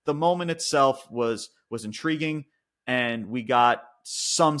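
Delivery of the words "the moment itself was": ordinary delivery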